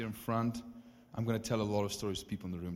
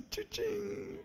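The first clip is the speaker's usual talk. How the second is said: squeaky high cha-ching. Pretend. Preacher mimicking a cash register, high squeak. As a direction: ring it like a till.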